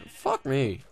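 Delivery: usual speaking voice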